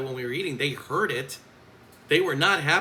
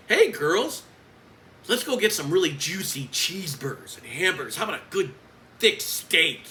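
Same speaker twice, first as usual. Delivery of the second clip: loud, higher-pitched mock-jolly offer; taunting; 5 semitones higher, 5 dB louder than usual. Coach imitates colleague teasing the girls about food, taunting. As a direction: relish each food word to needle someone queasy. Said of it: "Dude, you're not funny".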